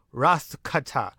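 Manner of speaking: slow, drawn-out "Wrath cutter" with altered vowels; silly put-on voice. Recapper savouring the renamed finishing move, silly and drawn out. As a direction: ham up WRATH CUTTER, ending on "cuttah".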